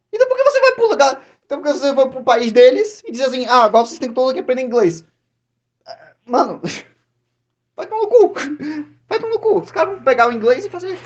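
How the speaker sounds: high, loud, fast exasperation; exasperated rant. Real, not acted. Fed-up man ranting at people who scorn language learners, exasperated. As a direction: burst out in high-pitched, incredulous protest. Mock rant at hypocritical complainers.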